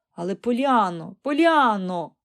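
loud, drawn-out protesting name call; reproachful. A narrator's reading. Aunt hurrying upstairs after her niece, reproachful. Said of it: "докоряла тітонька".

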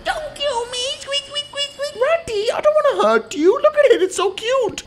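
very high tiny rat voice; tiny pleading rodent squeak; squeaky rat. Pretend. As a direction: squeak like a cartoon mouse.

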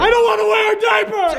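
whiny, shouted refusal; whining protest. Acted. Protesting the diaper forfeit.

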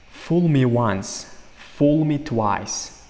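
very slow, each word laid out; slow model reading. Shown as a demonstration. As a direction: read it slowly for learners. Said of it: "Meni bir marta ahmoq qil".

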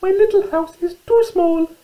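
very high, squeaky mouse voice; little mouse. Acted. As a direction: squeak it out like a tiny storybook mouse. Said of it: "said Little Mouse".

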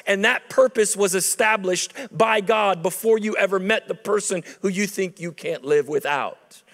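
ordinary delivery

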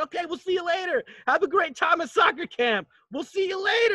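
chirpy shouted send-off; cheerful goodbye. Retold or imitated. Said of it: "They're like".

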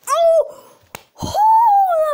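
very high, loud "oh"; startled gasping cry. Real, not acted. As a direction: let out a high, loud yelp of shock. Reacts to a near-blocked shot.

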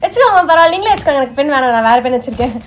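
loud, eager, pushy offer; eager insisting. Acted. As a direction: shove the pen on the teacher loudly. Eager pet offering pen. (ஆசிரியைக்கு பேனாவை வற்புறுத்தித் தரும் உற்சாகக் குரல்)

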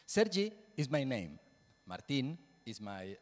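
normal talking voice

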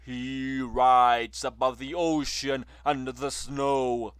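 grand declamatory praise; loud exalted recitation. A narrator's reading. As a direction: sing his glory over the crowd.

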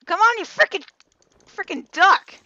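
high, loud burst of annoyance; exasperated yelling. Real, not acted. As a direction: yell at the duck in frustration. Yelling at a duck in game.

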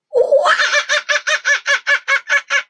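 high loud hooting monkey laugh. Acted. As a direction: hoot like a cheeky monkey. Monkey sound in the game.

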